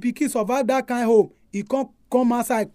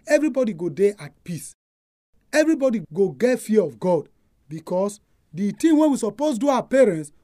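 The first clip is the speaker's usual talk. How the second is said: usual speaking voice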